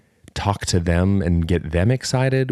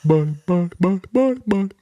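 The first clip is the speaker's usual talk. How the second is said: put-on friend's baby-talk voice; 9 semitones higher than usual. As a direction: imitate a man cooing to a dog, rising to squeaky. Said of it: "He starts talking like this".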